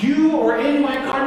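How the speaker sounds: heated retold confrontation; angry. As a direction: accuse hard, voice climbing. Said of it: "that much anger going on".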